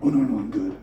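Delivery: Canada declining. Retold or imitated. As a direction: decline the offer politely, way down low. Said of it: "And they're just like".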